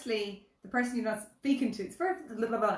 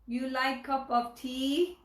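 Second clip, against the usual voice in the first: exaggerated slow broken English; mock broken English; 4 semitones higher, much slower than usual. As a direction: talk down to a foreigner slowly. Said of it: "my mother always speaks broken English".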